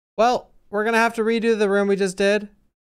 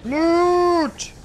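high drawn-out shout; greedy loot cry; 10 semitones higher than usual. Acted. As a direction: crow over the treasure.

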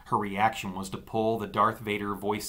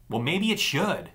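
retorting; 7 semitones higher, 4 dB louder than usual. A narrator's reading. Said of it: "she retorted".

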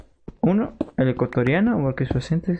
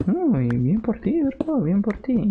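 high, slow praise; 7 semitones higher, slower than usual. Real, not acted. Praising a viewer who works.

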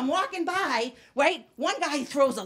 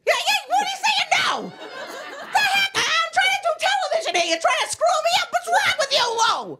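mock outrage; 12 semitones higher than usual. Mock scolding audience member.

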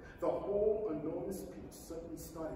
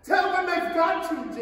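high and loud order; urgent command; 9 semitones higher, 11 dB louder than usual. Acted. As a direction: insist they keep going.

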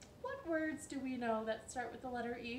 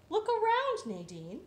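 dreamy hen; 10 semitones higher, 6 dB louder than usual. Acted. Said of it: "said Pizonka".

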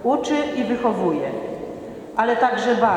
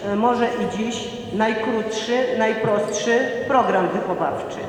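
ordinary talk, nothing marked

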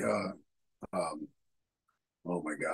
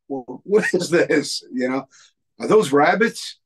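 high, loud and slow: are those rabbits; loud slow astonished question; loud disbelief; 7 semitones higher, 8 dB louder, slower than usual. Retold or imitated. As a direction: ask it loud and baffled. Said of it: "he's like".

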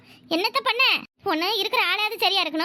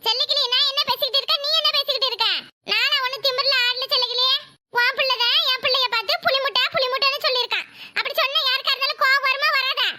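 higher, raised quarrelling; heated argument; 4 semitones higher than usual. Acted. Geetha argues with Sellakili over sons, heated and raised. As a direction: argue her son's side hard.

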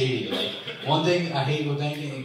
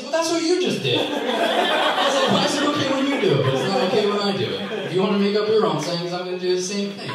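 much higher, louder, laughing indignant rant; heated comeback; 7 semitones higher, 5 dB louder than usual. Retold or imitated. Teller arguing with a customer, loud laughing indignation. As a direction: fire back, half laughing and worked up.

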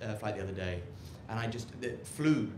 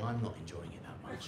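deadpan mutter; 8 semitones lower, 12 dB quieter than usual. Retold or imitated. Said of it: "sitting at home going".

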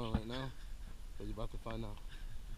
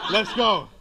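loud laughing hype; excited; 6 semitones higher, 12 dB louder than usual. Real, not acted. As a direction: cheer it out. Hyping the birthday run.

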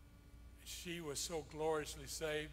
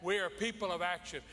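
louder, climbing, rousing exhortation; preaching at full force; 4 semitones higher, 4 dB louder than usual. Acted. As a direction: drive it home, slowing on don't stop praying.

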